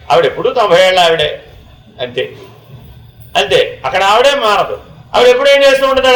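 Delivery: ordinary talk, nothing marked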